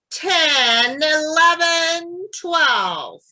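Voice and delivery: loud, high, drawn-out counting; counting with intent. Shown as a demonstration. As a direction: project each number like to the back row. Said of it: "So it's going to be like this".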